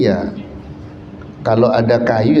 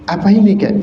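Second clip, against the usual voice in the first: higher, light questioning jin; jin asking; 7 semitones higher, much faster than usual. Acted. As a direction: jin offering the silver axe, voice higher. Jin offers iron axe.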